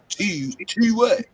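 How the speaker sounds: puzzled and high, dragging out "T, T what?"; mock confusion. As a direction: act baffled by the word T. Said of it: "I be like".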